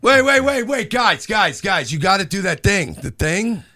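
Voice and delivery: high, loud excited interruption; excited shouting. Real, not acted. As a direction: cut in loudly and eagerly. Interrupting everyone, calling out.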